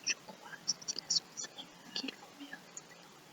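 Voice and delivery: very faint whisper; whispered echo. Shown as a demonstration. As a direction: whisper it back, hardly audible. Echoing the line for pupils.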